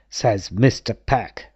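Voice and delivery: plain reading voice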